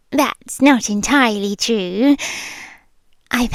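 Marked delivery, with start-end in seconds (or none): none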